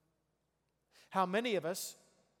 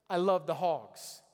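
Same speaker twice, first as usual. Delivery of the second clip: slow, louder, fervent declaration; excited fan; 5 dB louder, slower than usual. Shown as a demonstration. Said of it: "I'm gonna get excited".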